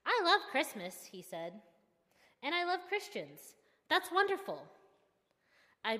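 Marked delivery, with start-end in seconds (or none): 0.1-1.1 high, loud, gushing enthusiasm; excited driver's higher voice; delighted. A narrator's reading. Delighted driver's reply, acted. 2.4-4.7 high-pitched, gushing enthusiasm; excited driver's higher voice; delighted. A narrator's reading. Delighted driver's reply, acted.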